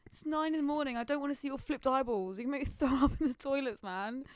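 retold outrage. At a boy in her form, exasperated retold outburst. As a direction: snap at the boy doing flipped eyeballs. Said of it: "I'm like".

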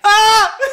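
short, high loud yelp; sudden high "Ah!", played for laughs; mock scream. Imitating a kid falling, high mock scream.

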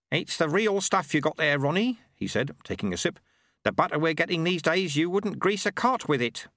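put-on a genial old man making small talk; Lutze, amused, over a cup of tea; old monk Lutze.

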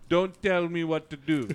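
"don't tell me what to do" with every T a D; T's turned to D's. Shown as a demonstration. Repeating the trick phrase for friends, D's for T's. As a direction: say "what to" as "whad do". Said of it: "You replace your T's with D's".

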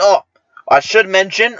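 normal talking voice

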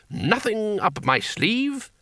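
high, slow conjuror's lilt; showman's patter. Acted. Wizard shows diners his empty sleeve, slow theatrical lilt. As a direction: present it slowly like a stage magician. Wizard performing like a stage magician.

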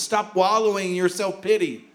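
ordinary talk

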